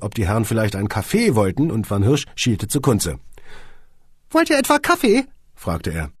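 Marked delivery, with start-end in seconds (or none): 4.3-5.3 high squeaky little man asking; small boss, pitched way up; Van Hirsch's voice. A narrator's reading. Boss asks his visitors, squeaky and high.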